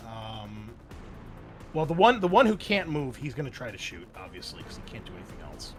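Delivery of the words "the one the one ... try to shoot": normal talking voice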